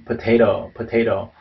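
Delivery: POTATO with the last T tapped, "puh-TAY-ro"; American "potato", full middle T and flapped final T; flapped second T. Shown as a demonstration. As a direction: say "potato" with its last T as a quick tap. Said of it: "끝에 거가 이렇게 플랩으로 발음되고".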